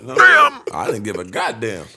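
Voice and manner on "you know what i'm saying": high, loud through laughter; bursting out laughing. Real, not acted. Laughing at his own story about the roommate, loud and high.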